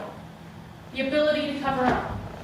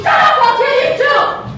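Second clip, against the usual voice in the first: much higher and louder furious scream in another voice; father yelling; 13 semitones higher, 13 dB louder than usual. Acted. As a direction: roar the threat like the violent father. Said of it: "until he yells at me".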